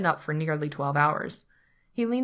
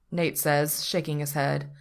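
ordinary delivery

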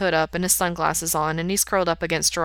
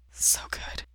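soft, unvoiced whisper; 7 dB quieter than usual. A narrator's reading. Lover to partner in bed, hushed whisper. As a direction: whisper it under your breath. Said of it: "Mikey whispers".

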